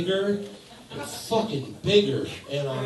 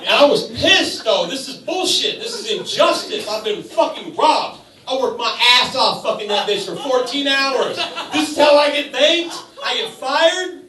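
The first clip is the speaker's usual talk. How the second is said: higher, louder outraged lines; indignant rant; 8 semitones higher, 4 dB louder than usual. Real, not acted. Comic fuming about being fired, indignant rant. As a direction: rail against the injustice.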